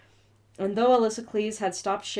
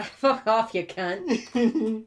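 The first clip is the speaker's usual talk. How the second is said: higher, playful Aussie swearing; mock Australian accent; 4 semitones higher, much slower than usual. Answering a friend in a bar, mock Australian accent. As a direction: toss it back in pub Australian.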